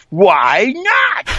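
high, shouted catchphrase with tongue stuck out; Dayton Allen. Acted. Imitating a comedian's running gag, high character shout. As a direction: holler it round a tongue caught in the teeth. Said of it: "stick his, his tongue and go".

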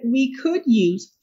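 very slow, each word set apart; slow pointed quote. Retold or imitated. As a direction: read their words out slowly, for effect. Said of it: "And their words, not mine".

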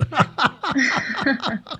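a high burst of real laughter. Real, not acted. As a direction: let the laugh break out high. Laugh after punchline.

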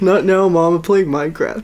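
put-on a kid waving mom away mid-game; mock gamer kid; brushing off mom.

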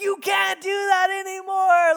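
very high, very loud yell of a retold outburst; furious shout. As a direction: blow up at a toddler right in his face. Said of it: "I lost it on my son".

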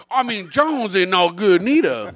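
put-on slow Cajun accent. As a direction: lay on a lazy Cajun drawl. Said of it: "I can do a better one than you".

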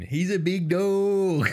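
high, slow mock Bife voice; a hammed-up Bife impression; imitating Bife. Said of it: "Bife saying".

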